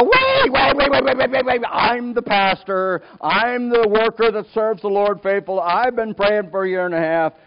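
high, frantic yelled objection; hammed-up outraged yelling; loud indignant protest. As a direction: burst in shouting, then stretch out the claim. Acts out protest over the money.

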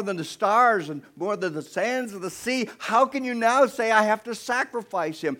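high, loud, imagined pleading with God; acted outcry of bewildered objection; shocked protest. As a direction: argue back in pressing disbelief. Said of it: "This had to be a shock".

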